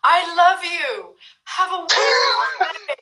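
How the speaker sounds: high, bright yelled blessing; beaming over-the-top goodwill, sung out high; cheery shout. Retold or imitated. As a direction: shout it sweetly after a rude cyclist. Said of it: "but I just shouted".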